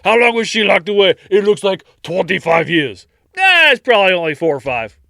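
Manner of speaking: loud, fast, high mock dialogue; careless family voices. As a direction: toss it off like it's nothing. Acted dismissive family dialogue.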